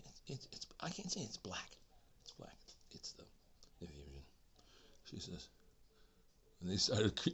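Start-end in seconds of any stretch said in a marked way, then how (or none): 0.1-4.2 hushed whisper. Retold or imitated. The woman whispering the sensitive word, a hesitant whisper.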